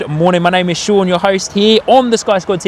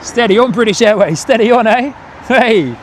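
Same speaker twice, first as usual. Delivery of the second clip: higher, animated coaxing of the pilot; excited urging; 6 semitones higher than usual. Real, not acted. Spotter urging a wobbling plane on stream, excited. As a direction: urge the plane on, pitched up.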